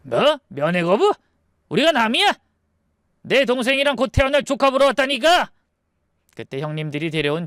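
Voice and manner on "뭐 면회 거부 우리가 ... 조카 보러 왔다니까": high, indignant outburst; raging protest. Acted. Eldest brother refused a visit to his sister, raging. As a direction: protest furiously with pitch jumping up. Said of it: "첫째 형님이 발악했어요".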